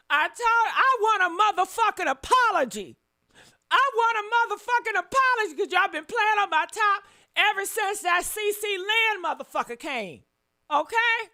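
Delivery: loud, high demand for an apology; indignant and unrelenting; furious demand. Real, not acted.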